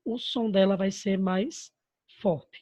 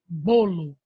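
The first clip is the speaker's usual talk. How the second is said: "bolo" with a loud, strong "bo" and weaker "lo"; teacher sounding out "bolo", heavy on "bo"; stress on BO; 2 semitones lower, 5 dB louder than usual. Shown as a demonstration. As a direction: punch the first syllable of "bolo". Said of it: "a sílaba mais forte é o bo".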